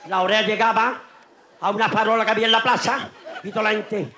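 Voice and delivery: ordinary talk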